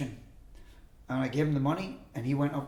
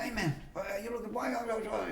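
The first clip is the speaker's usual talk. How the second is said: high, softer mock French Canadian voice; mumble-along French Canadian imitation, pitched up; French Canadian accent; 8 semitones higher, 5 dB quieter than usual. Stranger questions visitor, imitated French Canadian accent. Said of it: "you could hear he was French Canadian".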